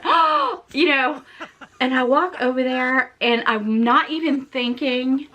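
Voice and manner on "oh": re-enacted high gasp of horror; high-pitched shocked intake; horrified gasp. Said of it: "I remember saying".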